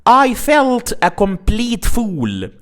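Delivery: slow emphatic "complete" in the example sentence. Shown as a demonstration. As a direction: read the example with strong emphasis. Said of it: "For example".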